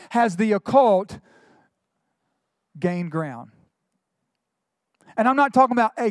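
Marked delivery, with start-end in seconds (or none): none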